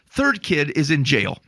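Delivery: ordinary talk, nothing marked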